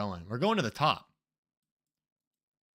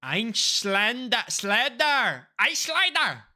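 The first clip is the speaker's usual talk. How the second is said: mock German reading; 9 semitones higher, 9 dB louder, much slower than usual. Loudly sounding out German signs.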